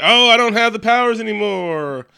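high, loud, hammed-up cry; mock exclamation. Hero waking without his powers, loud high mock cry. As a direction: wail it out with comic drama. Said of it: "He's like".

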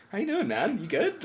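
casual lord greeting instead of a stiff one; casual bro greeting. Retold or imitated. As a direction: greet him like a buddy, not a lord. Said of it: "not like".

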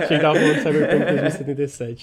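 quick words through a laugh. Real, not acted. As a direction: laugh while correcting yourself. Laughs at his own mix-up.

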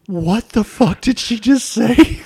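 high, loud, drawn-out bewilderment; retold stunned disbelief. Clerk reacting to a customer's bizarre story, stunned.

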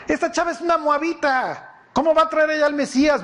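high-pitched scandalized objection; mock indignation. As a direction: object as a put-on doubter, pitched right up. Fulano objeta, indignado, en la escena. (indignación fingida, en tono agudo)

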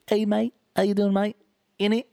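chirpy hammed-up Australian mate voice; Australian accent. Voicing the wallaby Rocco in a mock Australian accent, high and loud. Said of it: "Rocco's just like".

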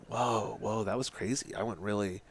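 imitating someone groggy, softly; hushed wonder coming out of trance; dazed awakening. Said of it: "she comes back up".

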